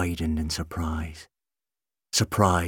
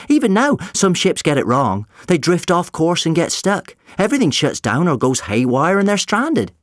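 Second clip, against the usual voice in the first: high, loud, animated youngster; eager boy; 10 semitones higher, 6 dB louder than usual. Acted. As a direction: explain it keenly as a boy. Voices eager boy in story.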